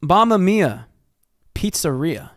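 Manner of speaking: high, sing-out mock Italian accent. Joker answering talk of Italians, in a mock Italian accent. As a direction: call it out in a cartoonish Italian accent. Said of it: "That was Italian twink".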